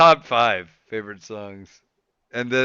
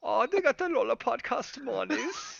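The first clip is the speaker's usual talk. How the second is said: high, soft mock German; hammed-up German, voice way up; terrible German accent; 11 semitones higher, 9 dB quieter than usual. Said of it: "That's a terrible German accent".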